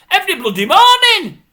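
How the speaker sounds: high, loud exasperated yell; outraged outburst. Real, not acted. Viewer fed up with the same items daily, outraged yell. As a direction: burst out at the end of your patience. Climax of rant, swearing.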